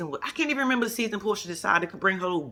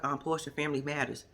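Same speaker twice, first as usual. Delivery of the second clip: lower, softer, dry; quiet deadpan producer; producer's aside; 4 semitones lower, 7 dB quieter than usual. Retold or imitated. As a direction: mutter it like a producer. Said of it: "the producer's like".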